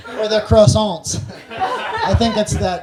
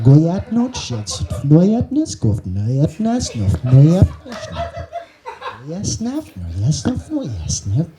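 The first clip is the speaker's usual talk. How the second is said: gibberish impression with swooping rising pitch; Bernie Sanders; much slower than usual. Comic doing his famous politician impression, absurd gibberish caricature. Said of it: "my famous Bernie Sanders impression".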